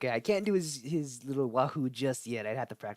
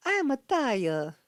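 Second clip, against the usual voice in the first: much higher, louder Mario impression; 12 semitones higher, 7 dB louder, slower than usual. Imitating the plumber Mario as he turns into a tiger. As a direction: announce it like the cartoon plumber. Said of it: "Mario turns into a tiger".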